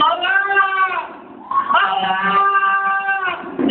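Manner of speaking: high, long-drawn call; ringing, drawn-out summons; angel calling out. Acted. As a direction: stretch the name out high, like a voice from heaven. The angel calls out to Abraham.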